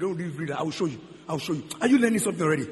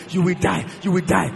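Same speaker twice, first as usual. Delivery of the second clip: ordinary delivery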